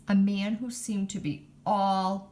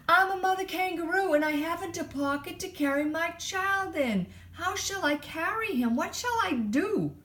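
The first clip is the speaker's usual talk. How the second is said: screamed up; 10 semitones higher than usual. A narrator's reading. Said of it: "screamed up at him".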